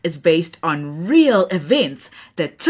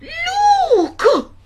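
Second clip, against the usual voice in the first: put-on very high, loud old woman cry; breathless granny shout; 5 dB louder than usual. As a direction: gasp it out like a panicked granny. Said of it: "puffed Gogo".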